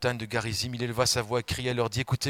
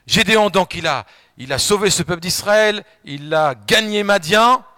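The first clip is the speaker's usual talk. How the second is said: proclaiming; 6 semitones higher, 10 dB louder than usual. Acted. Proclaiming Gideon's victory.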